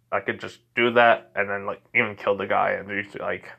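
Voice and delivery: usual speaking voice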